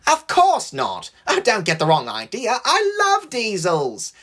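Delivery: hammed-up innocent enthusiasm; overdone protesting. A narrator's reading. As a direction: protest far too much, pitched high. James overprotesting his love of diesels.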